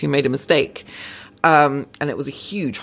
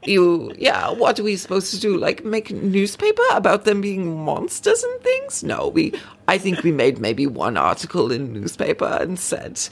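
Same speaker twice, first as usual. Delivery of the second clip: higher, hammed-up Norwegian, smugly patronizing; bad Norwegian accent; 5 semitones higher than usual. Norwegian police chief needling British listeners, patronizing bad Norwegian accent. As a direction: ham up the Norwegian accent, a touch arch. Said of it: "I'm gonna do a bad accent".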